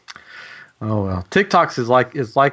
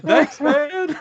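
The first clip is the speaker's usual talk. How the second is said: very high, laughing imitation; amused mock gratitude; bemused thanks. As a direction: thank a stranger in baffled, high-pitched voice. Retold bemused thanks from vloggers.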